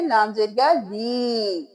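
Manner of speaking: "ली" stretched one alif length; one-beat madd. Shown as a demonstration. Teacher shows students "ली" lengthened by one alif. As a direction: lengthen "या ली" slightly, one count. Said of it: "एक अलिफ के बराबर खींचेंगे".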